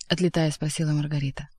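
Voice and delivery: ordinary delivery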